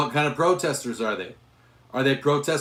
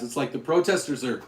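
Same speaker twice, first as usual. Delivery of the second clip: normal talking voice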